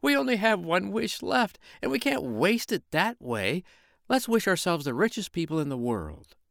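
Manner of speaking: high wife's voice, urgent; the wife pushing to wish for riches; high urgent pleading wife. Acted. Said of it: "she cried".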